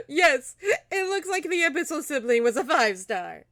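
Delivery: high, loud words through real laughter; laughing delight. Real, not acted. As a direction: crack up while talking. Still laughing at the reveal.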